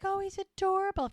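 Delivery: high-pitched mock cooing; acted fawning over a cute animal; gushing. Charmaine fawning over the found dog, high gushing.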